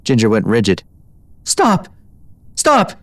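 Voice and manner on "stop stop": high, desperate cries of pain; crying out. A narrator's reading. Strapped mare crying out as rescuer pulls strap, desperate. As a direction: cry out to make it stop. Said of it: "she cried".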